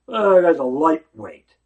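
loud, scornful brush-off; dismissive scoff. Retold or imitated. Someone dismissing another person as worthless, loud and scornful. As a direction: wave him off as a nobody.

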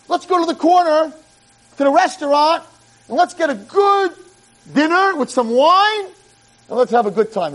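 high, inviting voice of a rabbi calling to a feast; mock rabbi. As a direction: coax them to dinner and wine. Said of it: "and I'd say".

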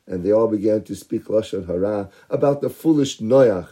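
ordinary delivery

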